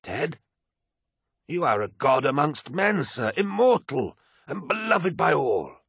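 high-pitched, fervent protest; aghast devotion. A narrator's reading. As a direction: insist with alarmed reverence, voice pitched high. Aide protests lord's talk of death.

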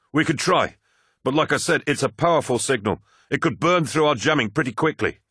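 flight officer voice. Acted. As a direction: voice a tense, hurried officer.

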